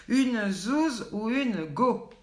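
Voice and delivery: very slow, stretched teasing question; game host teasing the viewers, stretched out; drawn-out quiz question. Acted. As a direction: dangle each word like a riddle.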